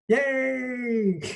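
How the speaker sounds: high, drawn-out yay; excited cheer. Real, not acted. Instructor kicking off the laughter meditation, excited cheer.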